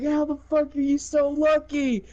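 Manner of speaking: high, incredulous mock protest; whiny-high retold disbelief; exasperated complaint. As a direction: imitate a frustrated losing opponent. Retold opponent complaining about lucky dice.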